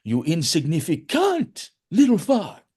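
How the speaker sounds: put-on high, drawn-out Italian-accented insult; imitated Italian accent. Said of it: "using an Italian accent rather than a Boston line".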